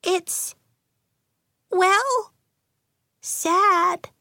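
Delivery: very high, halting little voice; hesitant small voice. A narrator's reading. Jocelyn introducing her sad story, high and hesitant. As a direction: pick out each word shyly with long pauses.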